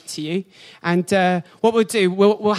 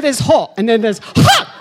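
normal talking voice

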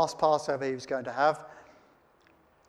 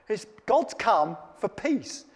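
ordinary delivery

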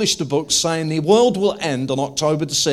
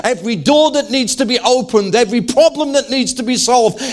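preaching at full force; 7 semitones higher, 4 dB louder than usual. Real, not acted.

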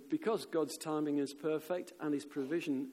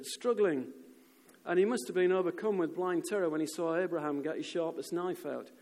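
normal talking voice

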